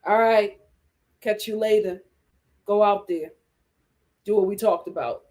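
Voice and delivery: a hint of a smooth pimp sending her off; lightly voiced slick pimp giving orders; low, laid-back mock pimp instructions. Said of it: "sitting in that car like a pimp".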